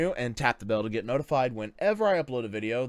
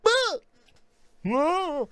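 very high, loud mock squeal; imitated cartoon yelp; 6 dB louder than usual. Mimicking Fluttershy who didn't even talk, squeaky yelp. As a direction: squeal two short wordless cries. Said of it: "It was like".

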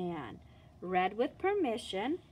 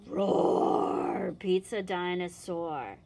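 louder breathy roar, lower; dinosaur roar; 4 semitones lower, 3 dB louder, slower than usual. Acted. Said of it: "What does a dinosaur say".

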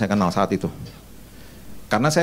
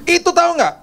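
much higher, louder nagging; wife's scolding tone; 4 dB louder than usual. Retold or imitated. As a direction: imitate the wife scolding on the phone. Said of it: "Ngomongnya gini dengan dan nadanya gini nih".

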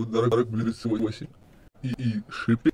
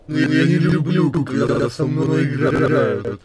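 louder, slow threatening voice; menacing threat; 3 semitones higher, 5 dB louder, slower than usual. Acted. Error Sans warns Blitz he hates being played, slow menacing threat. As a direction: warn her darkly.

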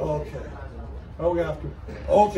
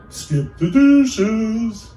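slow, higher call through the door; slow drawn-out delivery guy; 5 semitones higher, 5 dB louder, much slower than usual. Acted. Delivery guy calling through the door, slow and drawn out. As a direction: stretch it out slowly at the door.

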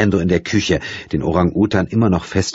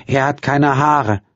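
put-on slow, higher, simple child voice; childlike voice; 9 semitones higher, slower than usual. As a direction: say it slowly like a child. Childlike daughter.

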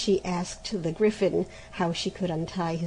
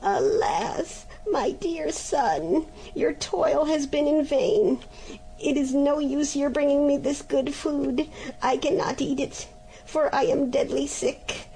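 higher, mock-sick moaning mother; groaning complaint; 6 semitones higher than usual.

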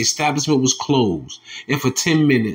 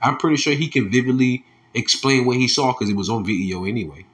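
ordinary delivery

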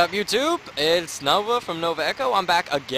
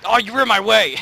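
excited outburst; 9 semitones higher, 9 dB louder than usual. Real, not acted. Fell in game, blaming friend.

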